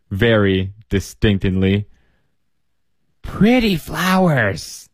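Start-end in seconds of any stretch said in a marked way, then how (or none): 3.2-4.9 put-on slow, high, insistent old lady; insisting old woman. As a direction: press the point sweetly, drawn out. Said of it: "the first woman insisted".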